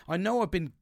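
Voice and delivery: ordinary talk, nothing marked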